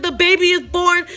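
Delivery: high, loud alarmed report; urgent re-enacted outburst; retold frantic announcement. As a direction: imitate a panicked caller blurting news. Mother frantic on emergency call.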